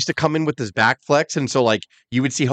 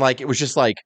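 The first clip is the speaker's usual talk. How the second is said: ordinary delivery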